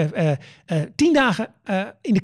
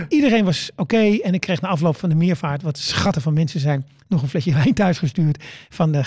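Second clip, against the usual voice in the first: ordinary delivery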